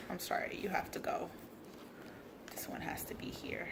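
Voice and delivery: soft whisper. Real, not acted. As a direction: whisper to yourself. Whispering while arranging.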